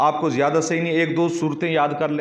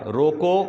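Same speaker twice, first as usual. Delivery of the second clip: "روکو" rising, then silence; stop after روکو; much slower than usual. Shown as a demonstration. Teacher shows where to fall silent, halting after "روکو". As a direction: say "روکو" alone and halt. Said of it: "روکو کے بعد وقف کر لیا".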